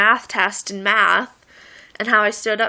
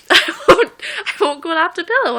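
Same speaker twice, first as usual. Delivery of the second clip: laughing; 7 semitones higher, 6 dB louder than usual. Real, not acted. Retelling texts while giggling.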